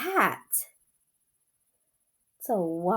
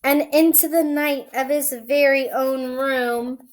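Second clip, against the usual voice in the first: story reading; 4 semitones higher, 5 dB louder than usual. A narrator's reading. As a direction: read it like a bedtime story. Reads picture book aloud.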